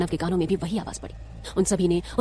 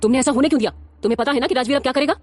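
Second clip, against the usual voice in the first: louder, fast, alarmed father's outburst; louder, fast, alarmed older man; shocked; 6 semitones higher, 5 dB louder than usual. A narrator's reading. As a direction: burst out in shock and worry. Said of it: "चौंकते हुए बोले".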